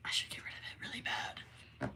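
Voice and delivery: whispered guilty confession; whispering. Retold or imitated. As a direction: hush it like a secret. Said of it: "and I'm like".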